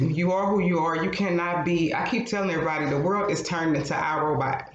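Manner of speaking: usual speaking voice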